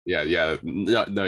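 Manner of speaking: usual speaking voice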